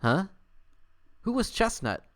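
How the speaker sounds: high, bewildered squeak of a question; confused squirrel asking who; puzzled huh. Acted. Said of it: "as if to say".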